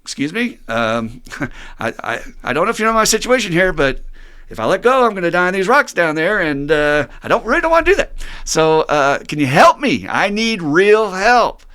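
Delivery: high, loud desperate begging; dangling man argues with the voice from above; frantic pleading. Acted. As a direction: plead with God, losing your grip. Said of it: "he's getting more and more frantic".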